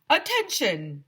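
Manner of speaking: announcing over the school loudspeaker; a light mock PA voice; loudspeaker call. A narrator's reading.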